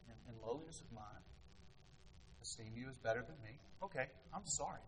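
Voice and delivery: soft, low, meek apology; a meek, soft-spoken sorry; humble apology. Retold or imitated. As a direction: apologise gently and quietly. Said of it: "I say".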